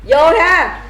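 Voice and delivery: rally the room to drink; calling out a toast. Real, not acted. Leading a toast countdown. (đếm to để cụng ly)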